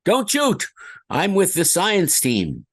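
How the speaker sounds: high, frightened cry for mercy; panicked plea. Acted. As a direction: beg them not to shoot. Scientist game line performed.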